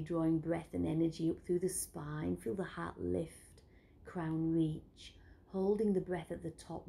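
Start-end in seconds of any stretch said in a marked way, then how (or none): none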